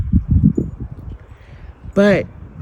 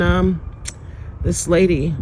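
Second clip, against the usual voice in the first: ordinary talk, nothing marked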